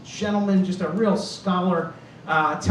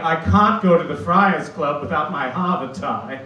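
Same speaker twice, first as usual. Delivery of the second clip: genteel Kennedy-type New England accent; 3 semitones lower than usual. Shown as a demonstration. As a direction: put on a nice-sounding Kennedy accent. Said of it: "Kennedy accent that's very nice sounding".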